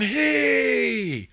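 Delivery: high, loud, slow announcing shout; calling out. Retold or imitated. Anthony announcing the grandson to the table, loud proud shout. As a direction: shout it to the whole room.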